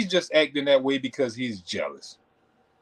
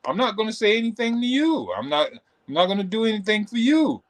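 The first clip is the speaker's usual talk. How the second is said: mock huffy retort; 7 semitones higher than usual. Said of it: "I was like".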